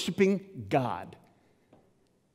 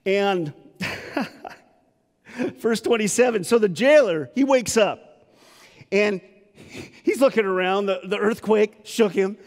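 normal talking voice

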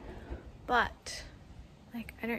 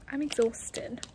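usual speaking voice

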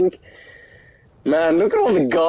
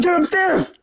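high urgent shout; yelling across the room. Real, not acted. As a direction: shout the order. Urging teammate in fight.

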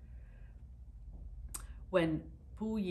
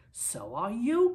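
louder, higher and slow retort; crying out; 8 semitones higher, 8 dB louder, slower than usual. Acted. Trade minister retorting to the war minister, loud slow cry. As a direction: fling it back in shock. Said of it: "cried the trade minister".

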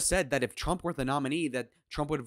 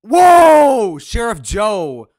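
much higher, far louder exclamation; surprised shout; 9 semitones higher, 20 dB louder, much slower than usual. Real, not acted. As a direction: yelp in real surprise. Reacts to Sheriff Joe mention.

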